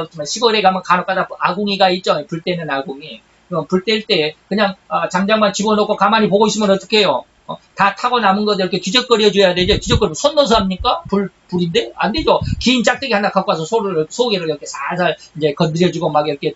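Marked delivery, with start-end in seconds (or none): none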